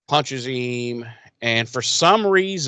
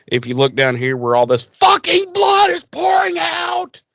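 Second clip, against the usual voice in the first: high-pitched, comically overblown shriek; exasperated mock scream; 4 dB louder than usual. Retelling wounded Slayer's reply to Riley, exasperated mock scream. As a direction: blow up into a hammed-up scream.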